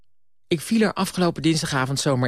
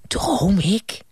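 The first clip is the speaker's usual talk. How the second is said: put-on high amazed boy's question; childish Bram; 9 semitones higher, 3 dB louder than usual. Bram waking in a TV studio, high amazed voice. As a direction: wonder aloud like a dazzled child.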